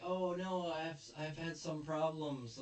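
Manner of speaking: put-on high, slow, pleading girl voice; distressed girl. Lightly voiced idol pleading with Onizuka for help. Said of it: "she's like".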